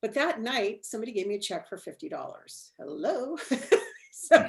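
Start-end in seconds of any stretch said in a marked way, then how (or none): 3.4-4.1 breathy laugh at the coincidence; amused laugh. Real, not acted. Guest laughs about the fifty dollar check, amused. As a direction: let a delighted laugh out.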